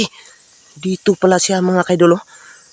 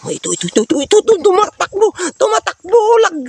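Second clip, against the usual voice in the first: rising higher and louder, frantic; excited pointing; 3 dB louder, faster than usual. Real, not acted. Vlogger spots the creature running, frantic excited calls. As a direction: point it out as it runs off.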